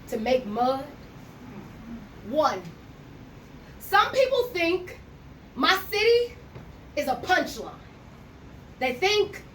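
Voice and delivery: measured, pausing poem reading; spoken word poem. Acted. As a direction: let each phrase land, with slow pauses. Second poem performance.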